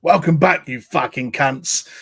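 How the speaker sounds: loud, menacing London geezer greeting; Billy Butcher impression. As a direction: growl it like Butcher. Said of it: "do my famed Billy Butcher impression".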